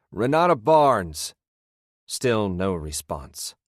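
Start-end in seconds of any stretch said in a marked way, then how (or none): 0.1-1.3 high, loud, slow call; shout. A narrator's reading. Narrator calls again for Renata, slow loud shout. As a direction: drag the name out as a shout. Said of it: "I shouted once more".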